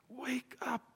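urgent whisper; intense, voiceless hush; saying it quietly. Real, not acted. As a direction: whisper the plea to the congregation. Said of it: "sometimes saying it quietly is".

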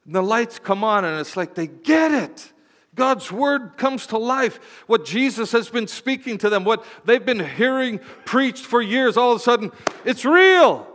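high, loud, rising sermon peaks; full-force preaching. Acted. Pastor preaching to the church about eyes opening, fervent. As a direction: preach it to the rafters.

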